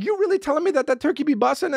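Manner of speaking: high, fast mock disbelief; comic incredulous questioning. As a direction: rattle off a baffled challenge. Questions praise of the turkey.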